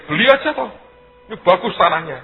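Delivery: high, loud, careless buy it; a carefree high shout, just buy it; breezy urging. Retold or imitated. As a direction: toss off the advice loudly and high. Mock breezy advice.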